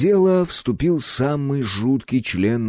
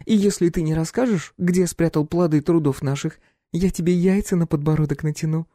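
playful threat; 9 semitones higher than usual. A narrator's reading. Klyopka threatening captive.

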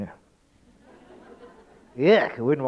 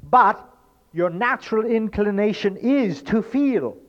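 high, loud, driving sermon voice; fervent preacher building intensity; impassioned preaching; 8 semitones higher, 6 dB louder than usual. Acted. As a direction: preach it hard to the crowd. Preaches at full force.

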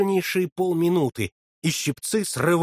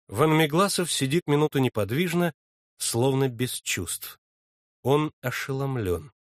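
plain reading voice

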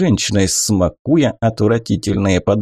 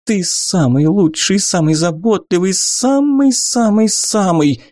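high gushing coo, slowing at the end; lavish him with sweet praise; caressing praise; 9 semitones higher than usual. A narrator's reading. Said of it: "Голос журчал, ласкал, обволакивал". (воркующе, с восторгом)

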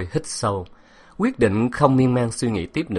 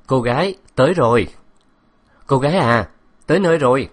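driver calling loudly to a passenger; louder, slightly higher call; 4 semitones higher, 6 dB louder than usual. A narrator's reading. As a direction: raise the voice to get her attention. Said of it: "Bác Tài lớn tiếng gọi hai lần".

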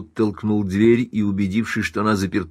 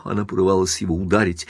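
ordinary delivery, nothing marked

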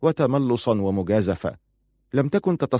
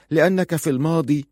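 higher-pitched, understated bitterness on "في الماضي"; bitter; 7 semitones higher than usual. A narrator's reading. Wife explains her fear before being cut off, bitter. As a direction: give the reason bitterly, voice lifted. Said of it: "قالت في مرارة".

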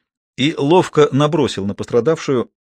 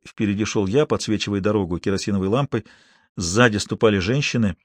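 usual speaking voice

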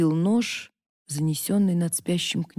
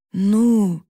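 hoarse, loud bark; rasped shout; 2 semitones higher, 6 dB louder than usual. Acted. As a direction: rasp it out hard. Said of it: "хрипнул".

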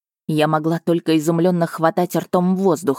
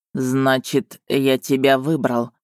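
stiff, wooden, lower flat delivery; 3 semitones lower, slower than usual. A narrator's reading. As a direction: say it woodenly, no life. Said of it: "деревянным голосом произнёс он". (деревянным голосом)

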